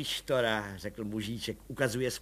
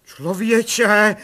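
louder, higher breathless cry; 10 semitones higher, 13 dB louder than usual. A narrator's reading. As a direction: burst out without breath. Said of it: "vykřikl plukovník bez dechu".

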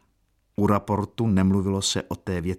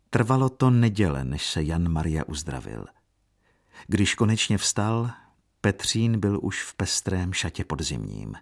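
plain reading voice

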